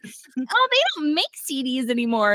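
high, airy mock-naive remark; playing dumb. Barbie misreading CDs to Ken, high mock-naive voice. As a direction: say it like you honestly don't get it. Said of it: "Pretend you don't know anything".

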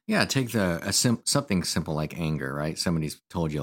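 ordinary talk

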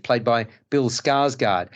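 usual speaking voice

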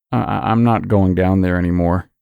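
plain reading voice

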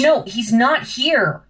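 slow, loud, exasperated; frustrated and drawn out; loud frustration. Acted. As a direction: announce it loudly, dragging out each word. Target missing from the room.

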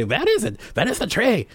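hammed-up German tourist accent. Son mimics German tourists finding the tree, German accent. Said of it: "That's my German accent".

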